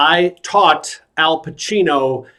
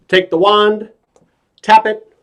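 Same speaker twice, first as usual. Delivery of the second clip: high, suspended step-by-step delivery; magician's patter; 7 semitones higher, 3 dB louder than usual. Acted. Clown walks actor through trick, high showman patter. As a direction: keep each step pitched up.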